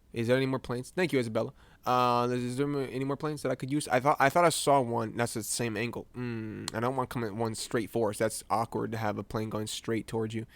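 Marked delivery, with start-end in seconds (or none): none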